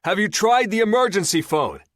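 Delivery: man shouts a question to the trapped woman. A narrator's reading. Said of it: "this time a little louder".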